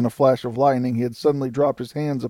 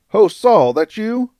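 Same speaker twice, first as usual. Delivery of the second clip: high, raised hailing call; 9 semitones higher, 7 dB louder, slower than usual. A narrator's reading. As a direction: hail a rider across a distance. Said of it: "cried a voice".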